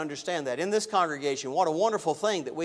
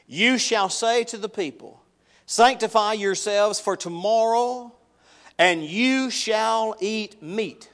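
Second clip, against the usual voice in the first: preaching scripture; 3 semitones higher, 4 dB louder than usual. Acted. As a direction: proclaim God's words to the congregation. Reads God's words in full preaching voice.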